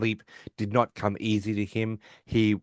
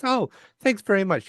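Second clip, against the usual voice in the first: quoting Wheaton, pitched up and chirpy; a bright, flattered little thank-you; high pleased thanks; 12 semitones higher than usual. Retold or imitated. Retelling Wheaton thanking Howard for the compliment, high and chirpy.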